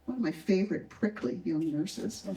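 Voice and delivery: plain reading voice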